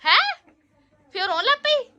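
high loud disbelieving questions; shocked loud asking; surprised. Real, not acted.

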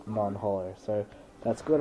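usual speaking voice

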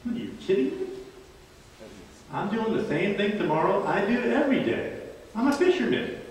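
a mild mock disbelief; voicing Peter. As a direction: Peter stating the obvious. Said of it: "And Peter says".

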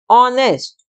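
"ऑनेस्ट" said "on-est", with no H sound; honest with silent h. Shown as a demonstration. Teacher modelling "honest" for students, "ऑनेस्ट" with a silent H. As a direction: model "ऑनेस्ट" starting on an open "o" vowel. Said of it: "ऐसे हम बोलते हैं इसको ऑनेस्ट".

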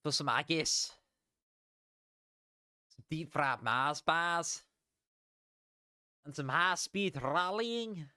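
a bad, half-hearted Scottish accent, slow; mock Scottish accent. Streamer previewing the Scotland round, in a bad Scottish accent. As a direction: ham up a clumsy Scottish accent. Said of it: "I can't do a fucking Scottish accent".

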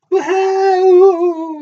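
very high, loud wahoo; excited whoop. Acted. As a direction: burst out with glee as if tickled. Little animal tickled off chair.

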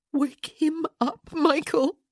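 slow, high, tearful plea; desperate pleading. A narrator's reading. Stricken wife looking up at friend, pleading desperately. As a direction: beg him, drawing out each word.

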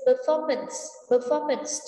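"performance" stressed on FOR; stress on second syllable. Shown as a demonstration. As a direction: punch the middle syllable of "performance". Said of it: "trọng âm số hai". (nhấn âm hai của "performance")

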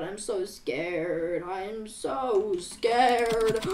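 very soft, drawn-out scared plea; hushed fear. Pretend. Player telling the others of being scared, in a hushed, slow voice. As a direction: draw out the fear in a small low voice.

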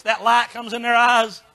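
ordinary talk, nothing marked

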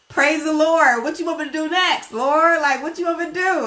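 bright, high, eager praise to the Lord; calling out happily, ready for the next task; joyful praise. Pretend. A believer eagerly asks the Lord what comes next, high and joyful.

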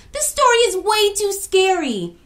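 loud, high frightened complaint; scared girl begging the storyteller to stop; scared shout. A narrator's reading. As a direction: beg him to stop. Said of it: "shouted Equia".

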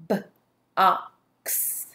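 "B-ox" broken into separate sounds; lone b sound, then "ox" drawn apart in "B-ox". Shown as a demonstration. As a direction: tap out "B-ox", pausing after the B.

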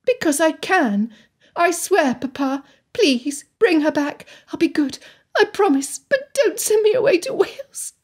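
high, desperate plea; rising plea on the edge of cracking; pleading, voice cracking. A narrator's reading. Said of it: "To her shame, her voice cracked".

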